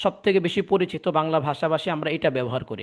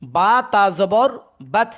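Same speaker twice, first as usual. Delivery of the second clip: slow, louder teaching recitation; slow spelling-out; 2 semitones higher, 4 dB louder, slower than usual. Shown as a demonstration. Teacher re-explains jazm to confused student, slow recitation. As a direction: stretch each letter name out firmly for the class.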